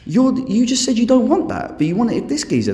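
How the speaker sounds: high, exasperated dude; mock disbelief at a double standard; incredulous scolding. Scolding hypocrites in a quote.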